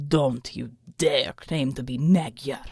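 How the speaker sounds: slow, low menacing snarl. A narrator's reading. The thug snarls at Alma, a low slow threat. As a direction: growl the warning down low. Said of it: "he snarled".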